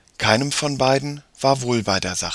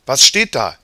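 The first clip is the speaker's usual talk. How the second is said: louder, higher eager question; curious boy; 4 semitones higher, 8 dB louder than usual. A narrator's reading. Jakob asks what the page says, curious. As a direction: ask it out like an eager child.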